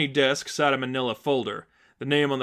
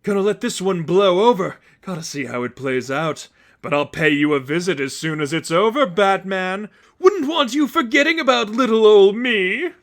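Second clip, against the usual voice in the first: high, loud manic Joker; cackling madman, gleeful and loud; 7 semitones higher, 7 dB louder than usual. A narrator's reading. Joker gloating to Batman about the Seraphim, high manic voice. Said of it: "He laughed as the police pushed him".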